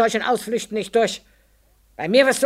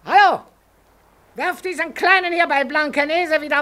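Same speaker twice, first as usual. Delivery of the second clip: calling out; 7 semitones higher than usual. Acted. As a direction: hail someone far off. Calling out across the water.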